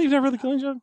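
high disbelieving "really?"; surprised. Real, not acted. Host can't believe cohost never read it.

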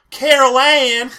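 high, loud, drawn-out Southern call; mock Southern holler; southern accent. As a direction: call the name out with a stretched Southern twang. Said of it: "she has this soft southern accent".

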